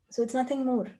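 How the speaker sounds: ordinary talk, nothing marked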